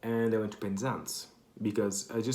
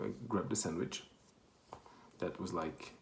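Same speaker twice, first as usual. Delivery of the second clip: normal talking voice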